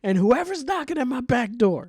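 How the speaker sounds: high, strained cartoon old man; mock sixty-two-year-old man. Old man ranting about his cousin, high mock voice. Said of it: "a sixty-two-year-old man was just like".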